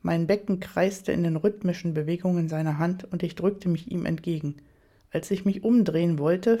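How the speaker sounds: ordinary delivery, nothing marked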